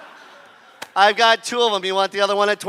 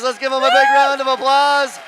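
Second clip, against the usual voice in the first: ordinary delivery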